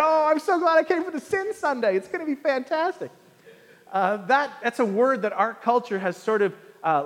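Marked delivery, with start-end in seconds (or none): 0.0-3.1 higher, sarcastic glee; sarcastic mock-excited congregant; mock enthusiasm. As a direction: play a falsely thrilled churchgoer.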